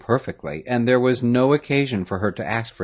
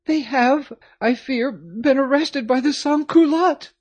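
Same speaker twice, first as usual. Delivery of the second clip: mournful. A narrator's reading. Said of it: "Marie said mournfully".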